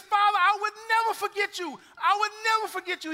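a son overwhelmed to see his father again; trembling half crying. Acted. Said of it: "you can hear the trembling in his voice".

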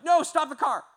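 high, loud warning call; urgent shout. Retold or imitated. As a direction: yell to stop. Stopping at red light.

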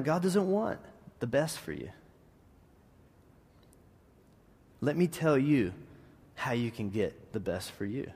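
low, soft, sly serpent voice; a hushed, crafty tempter. Retold or imitated. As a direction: coax her quietly like the serpent. Serpent's lie voiced by preacher.

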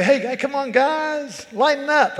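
high, casual "hey hey, come on" with no bite; a hint of a laid-back buddy calming friends; mild easygoing rebuke. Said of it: "He didn't say".

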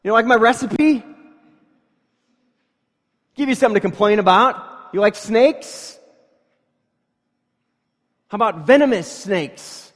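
high, loud sarcastic retort, quick at first; mock indignant God. God mocking the grumbling people, loud sarcastic voice. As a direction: sneer back at the complainers, loud and high. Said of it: "God's like".

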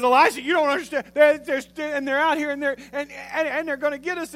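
high, loud, stammering panic; freaking out. Acted. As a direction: act out a terrified servant tripping over words. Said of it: "he's freaking out".

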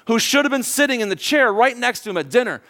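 ordinary delivery

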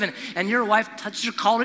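ordinary talk

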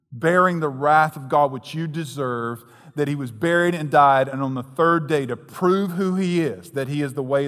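loud, fervent sermon climax; rising fervent gospel preaching; full-force preaching. Acted.

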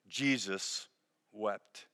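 softly, letting each word fall; a hushed, grave pause between the words; solemn hush. Acted. Pastor reading the shortest verse to grieving listeners, hushed. Refrain after the loud question.

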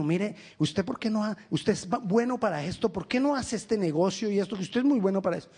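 usual speaking voice

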